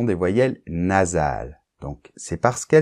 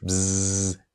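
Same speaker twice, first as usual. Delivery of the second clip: soft imitated buzz of a fly; 6 dB quieter than usual. Shown as a demonstration. Teacher imitating a fly to show the Z sound, soft buzz. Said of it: "comme la mouche".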